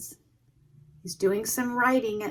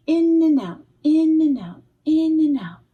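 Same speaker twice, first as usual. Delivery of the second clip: louder, higher chant of in and out; rhythmic refrain; 7 semitones higher, 6 dB louder than usual. A narrator's reading. Reader chanting the needle going in and out, louder. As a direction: beat out the sewing rhythm.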